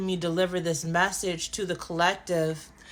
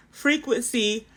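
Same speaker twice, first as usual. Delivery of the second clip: words pulled long by a yawn; 3 semitones higher, 3 dB louder than usual. Real, not acted. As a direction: speak through a yawn. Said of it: "I can't stop yawning".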